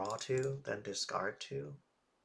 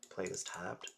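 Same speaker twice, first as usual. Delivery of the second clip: ordinary delivery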